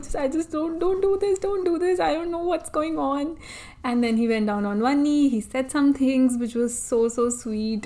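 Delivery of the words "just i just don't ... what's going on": high, emotional mock pleading; tearful, overwhelmed. Bride retelling her reaction to the proposal, tearfully overwhelmed. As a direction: re-enact being overwhelmed, voice pitched up. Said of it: "I was so emotional".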